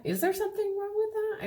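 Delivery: incredulous high-pitched echo. Shown as a demonstration. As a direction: throw it up high like it's absurd. Repeated, jumping much higher.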